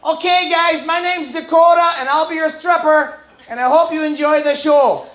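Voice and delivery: chirpy small-town stripper greeting; mock stripper intro. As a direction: stripper introducing herself, high and sweet. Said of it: "She walks out".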